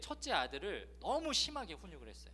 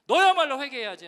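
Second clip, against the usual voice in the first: God's voice scolding the pastor, louder and louder; rebuking inner voice; 6 semitones higher, 9 dB louder than usual. Retold or imitated. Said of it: "라는 마음을 갑자기 하나님 주시는데". (목사를 꾸짖는 하나님의 음성, 점점 커지는 호통)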